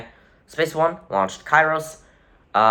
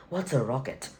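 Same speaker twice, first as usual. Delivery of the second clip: quiet, exaggerated mock British accent; horrible British accent; 9 dB quieter than usual. Correcting the British government impression, in a horrible British accent. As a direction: redo it softly in a bad British accent. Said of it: "Horrible accent".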